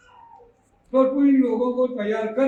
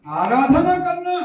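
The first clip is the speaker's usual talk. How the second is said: preaching emphasis; 7 semitones higher, 6 dB louder, slower than usual. Acted. Preacher quotes brother, peaks.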